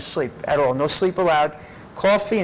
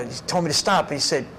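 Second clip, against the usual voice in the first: usual speaking voice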